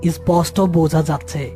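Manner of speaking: ordinary delivery, nothing marked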